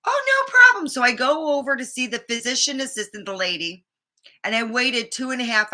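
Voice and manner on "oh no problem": high, bright mock receptionist voice, much louder; chirpy receptionist. Retelling the front desk lady, chirpy and much higher. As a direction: toss it off sweetly like a front desk clerk.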